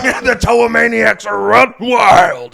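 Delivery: loud, raspy wrestler promo yell; Ultimate Warrior impression. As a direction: roar it like a wrestling hero.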